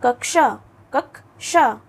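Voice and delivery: कक्षा with the क held, then श released; model pronunciation. Shown as a demonstration. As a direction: say कक्षा slowly and clearly for pupils. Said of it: "क के लिए थोड़ी सांस को रोकते हैं". (कक्षा का स्पष्ट उच्चारण, क पर रुककर)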